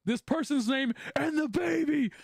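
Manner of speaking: high, drawn-out mock yell; retold yelling. Walking around the trailer crying out, mock yelling. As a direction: act out yelling, slow and high. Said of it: "I'm yelling at the top of my lungs".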